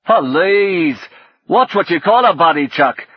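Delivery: put-on loud, high, theatrical Northern English accent; brash, hammed-up Northern English outburst; exaggerated Northern English. A narrator's reading. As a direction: demand it in over-the-top Northern English. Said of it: "impossibly exaggerated, theatrical Northern English".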